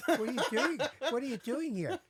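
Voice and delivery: high, loud amazed questioning; mock astonishment. Parents find TV actor at their door, astonished exclaiming. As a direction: imitate flabbergasted parents, pitch jumping up.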